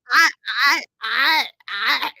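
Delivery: a comic stuck-for-words stutter; stammering. Retold or imitated. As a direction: freeze up and stammer. Freezing when asked house number.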